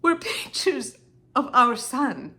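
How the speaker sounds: high, halting, with breaking intensity; anguished disclosure. Acted. Wife tells Henry their son was pictured, anguished.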